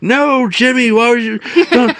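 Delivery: high, loud scolding plea; frantic handler. Retold or imitated. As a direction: cry out high like a keeper scolding a pet. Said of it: "the handler is like".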